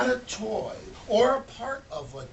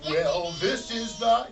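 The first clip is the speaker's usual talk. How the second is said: plain reading voice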